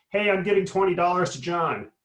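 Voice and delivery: half-hearted shout to a whole hall; mock town-hall shout. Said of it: "I shout at the top of my lungs".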